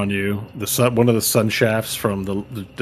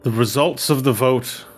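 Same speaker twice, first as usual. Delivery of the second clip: raised, formal ceremonial address; solemn elven elder; 4 semitones higher than usual. Acted. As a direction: proclaim like a council elder. GM voices elven council leader.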